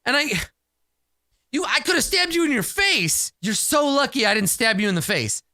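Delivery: high-pitched scolding without real volume; mock yelling. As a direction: act out telling off a reckless kid. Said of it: "so I'm just yelling at him".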